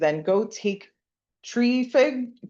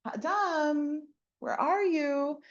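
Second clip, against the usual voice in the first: calling by name; 6 semitones higher, 4 dB quieter, much slower than usual. Retold or imitated. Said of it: "God doesn't say".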